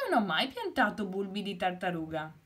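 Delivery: lightly voiced baffled hen. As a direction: rattle off her bewilderment.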